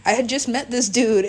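usual speaking voice

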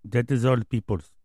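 lightly voiced Dominican slugger, broken English; a hint of David Ortiz's Spanish-accented English. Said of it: "Thank you, David Ortiz, our special guest".

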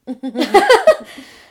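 bursting loud laugh at herself; real loud laugh. Real, not acted. Storyteller cracking up at her own panic, loud laugh. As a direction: let the laugh explode out.

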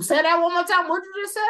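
high, thrilled call to repeat; excited hype. Real, not acted. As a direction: egg them on, delighted. Hyping up a co-host's point.

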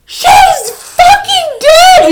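very high, drawn-out scream of shock; horrified wail. Retold or imitated. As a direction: wail it slowly in horror, sky-high. Retold horrified discovery of dead dog.

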